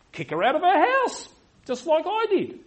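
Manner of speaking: high, loud sarcastic quote; mock indignant shout. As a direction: throw out the hypocrite's advice at full voice. Scornful acted advice from hypocrite pastor.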